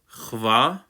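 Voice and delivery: "chva" with kh as in loch; back-of-throat ch. Shown as a demonstration. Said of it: "Ch sound like you would hear in Hebrew".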